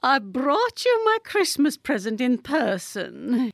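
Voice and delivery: grand lady bestowing a treat; condescending treat. A narrator's reading. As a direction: offer it as a great favour. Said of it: "tone of voice of one giving an unheard of treat".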